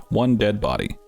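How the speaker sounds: plain reading voice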